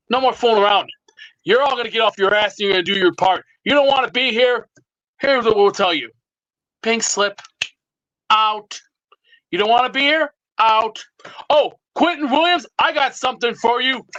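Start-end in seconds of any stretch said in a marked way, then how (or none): none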